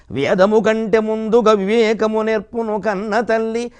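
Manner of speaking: slow, lilting recitation of a verse; poem recital. Shown as a demonstration. Speaker reciting his verse about his mother to the audience, slow recital. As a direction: recite it like a padyam in an assembly, stretching each word. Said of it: "పద్యం చెప్పాల్సి వస్తే".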